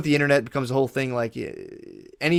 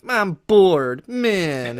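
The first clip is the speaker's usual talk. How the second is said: higher, louder, dragged-out whine of boredom; whiny bored complaint; 5 semitones higher, 4 dB louder, much slower than usual. Retold or imitated. Mocking a modern person who whines about being bored. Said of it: "be like".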